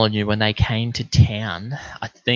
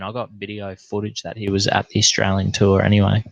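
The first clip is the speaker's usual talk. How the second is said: usual speaking voice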